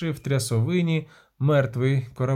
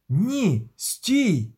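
higher-pitched cry to stop; urgent warning; 4 semitones higher than usual. A narrator's reading. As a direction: warn someone off in alarm. Warning sailor off the snake.

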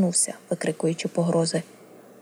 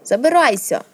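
higher, louder narrated shout; angry cry; 5 semitones higher, 5 dB louder than usual. A narrator's reading. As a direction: cry it out in anger, driving someone away. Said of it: "крикнула їй сестра крізь гнівні сльози".

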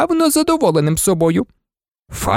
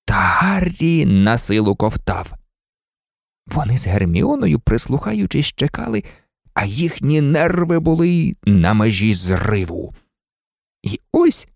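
usual speaking voice

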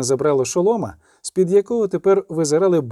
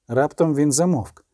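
ordinary delivery

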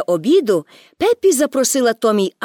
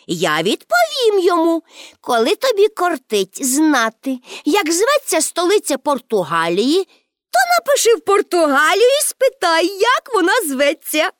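put-on high, animated child's retort; cheeky girl Pippi; 6 semitones higher than usual. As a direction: answer back in a high girlish voice. (зухвалий дитячий голос)

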